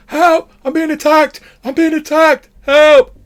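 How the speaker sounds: high, loud terrified shrieks; fleeing technician screaming for help; panicked scream for help. Acted. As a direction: scream down the corridor for rescue. NPC runs shouting for help.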